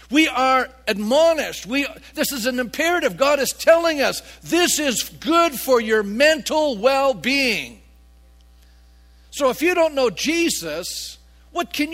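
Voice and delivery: loud, high, weighty exhortation; forceful preaching. Real, not acted. Preacher telling church it is God's imperative, forceful. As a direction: hammer home the command.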